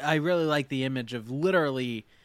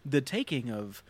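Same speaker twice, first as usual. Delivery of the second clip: ordinary talk, nothing marked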